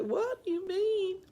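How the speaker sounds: high, slow mock-innocent question; quoting a client. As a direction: squeak it out like a puzzled client. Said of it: "They say".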